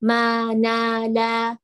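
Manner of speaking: "Ma, na, la" held on thin, open vowels; flat-tongue letters. Shown as a demonstration. Teacher demonstrates open-tongue letters, thin and held. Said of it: "lidah kita tuh kan datar".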